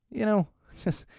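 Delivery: ordinary talk, nothing marked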